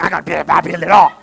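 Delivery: loud, high cocky bragging; mock macho bike guy shouting; jerky know-it-all. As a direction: brag like you can fix it all alone. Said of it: "you get that jerky attitude".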